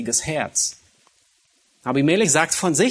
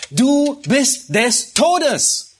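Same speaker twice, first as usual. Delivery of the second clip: high, slow, solemn pronouncement of doom; God's death sentence; 9 semitones higher, 4 dB louder, much slower than usual. Retold or imitated. Preacher voicing God pronouncing Abimelech's death sentence, slow and solemn. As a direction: deliver the verdict slowly, like God passing sentence. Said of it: "Gott sagt".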